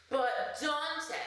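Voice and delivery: high, sing-song mock plea; imagined viewer interrupting. An imagined viewer asks once again, high sing-song plea. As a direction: sing out the name like a pestering viewer. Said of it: "I hear you say once again".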